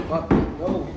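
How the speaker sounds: sharp loud "fuck, no"; a loud, disgusted refusal; retold loud refusal. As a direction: shove the words out loud and firm. Pushing off an unwanted advance.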